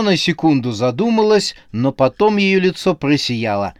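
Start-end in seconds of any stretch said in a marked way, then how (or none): none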